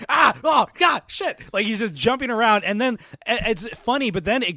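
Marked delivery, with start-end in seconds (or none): 0.1-1.4 yelping in pain, much higher and louder; pained yelping shout. Retold or imitated. Barefoot henchman on a burning floor, yelping in pain. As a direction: hop and yell as the floor burns your feet.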